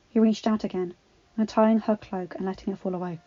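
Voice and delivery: usual speaking voice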